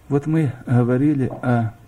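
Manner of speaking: ordinary talk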